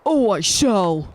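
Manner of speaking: slow, grand character voice; Susano voice. Acted. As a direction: draw it out like a proud warrior. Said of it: "Go ahead, be Susano".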